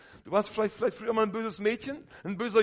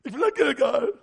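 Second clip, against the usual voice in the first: put-on higher, louder childish demand; whiny child; 5 semitones higher, 6 dB louder than usual. Preacher mimicking a toddler demanding Gilgal, high and loud. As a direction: whine it like a spoiled kid.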